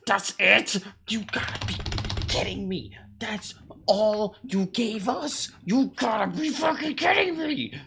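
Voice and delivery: high, loud disbelieving outrage; outraged shouting. Real, not acted. Viewer yelling at a too-short teaser, outraged. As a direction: escalate into a high, incredulous yell.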